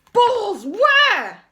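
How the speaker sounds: high, urgent shouted question; loud demand. A narrator's reading. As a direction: demand it at full volume. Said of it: "he demanded".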